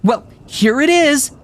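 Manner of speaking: very high, loud furious rant; girl losing her mind at her friends, furious; manic and furious. A narrator's reading. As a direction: lose it completely at them. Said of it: "manic and furious".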